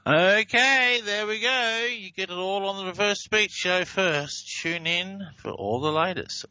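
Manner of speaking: upbeat show plug, high and bright; radio promo announcer. Acted.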